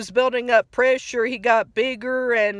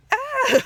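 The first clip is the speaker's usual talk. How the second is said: a shrieking cry of pain; mock scream of pain; 14 semitones higher than usual. SpongeBob in pain, retold.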